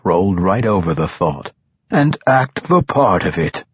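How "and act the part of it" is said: ordinary delivery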